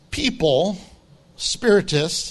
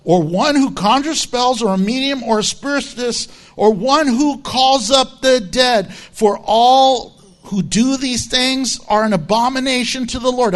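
louder, forceful verse reading; emphatic scripture reading; 3 semitones higher, 4 dB louder than usual. Real, not acted. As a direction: drive the verse home, raised voice. Rereads verse emphatically to objectors.

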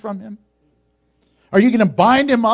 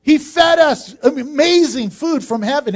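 yelling out; 7 semitones higher than usual. Pretend. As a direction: shout it like grateful healed people defending him. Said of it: "These people should be yelling out".

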